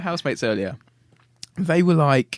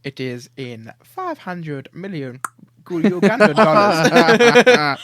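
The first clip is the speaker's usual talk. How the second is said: slow mock African scam caller, swelling louder; Phone Jacker; 2 semitones higher, 11 dB louder, much slower than usual. Naming a lottery prize, hammed-up scam caller. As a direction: stretch it out like a prank caller. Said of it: "It sounds like phone jacker".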